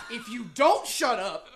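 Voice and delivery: loud, slow mock threat; escalating threat. Acting out the next stage of a shut-up match, hammed-up threat. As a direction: draw out the warning, loud and laughing. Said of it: "the shut ups get higher and higher".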